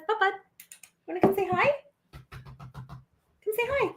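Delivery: high, soft pet-talk; coaxing a pet. Real, not acted. Owner asking her dog to say hi, coaxing. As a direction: sweetly call the dog over, voice up high.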